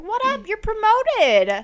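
high, playful mock royal announcement; playful high-pitched promotion call; mock king announcing. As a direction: toss it out high and sing-song. Voices the king promoting them.

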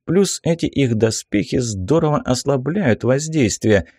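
plain reading voice

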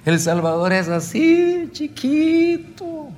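high, stretched-out wail on the last word; acted crying, squeezed up high and slow; retold tearful plea. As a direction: re-enact weeping as the pitch climbs. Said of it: "Y yo seguía llorando".

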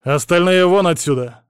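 loud, high-pitched command; barked order. A narrator's reading. Captor dismissing the guards, barking. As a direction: bark them out of the room. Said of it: "рявкнул я".

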